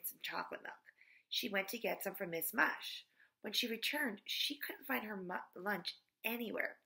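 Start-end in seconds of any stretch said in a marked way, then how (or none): none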